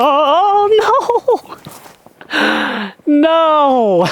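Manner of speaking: dismayed cry. Real, not acted. Putt lips out of basket.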